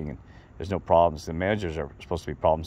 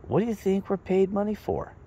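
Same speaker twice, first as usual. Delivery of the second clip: lightly voiced boss, pitched high; imitating Steve, higher than own voice; quoting the boss; 13 semitones higher than usual. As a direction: put the question to him like a wry mentor. Boss Steve's quoted pointed question.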